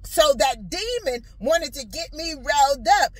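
usual speaking voice

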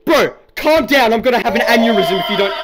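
yelling at a pushy customer, near breaking; exasperated yell. Real, not acted. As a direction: shout it, at your limit.

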